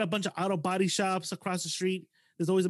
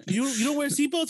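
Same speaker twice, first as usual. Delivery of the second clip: higher-pitched disbelief; incredulous retold question; 7 semitones higher, 4 dB louder than usual. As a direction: ask it like you can't believe it. Retells his shocked question to in-law.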